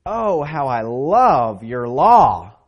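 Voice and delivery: slow, loud, exclaimed; fervent psalm quote; with emphasis. Shown as a demonstration. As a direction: say it like an exclamation point. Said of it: "with an exclamation point, with emphasis".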